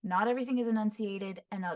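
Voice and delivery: ordinary talk, nothing marked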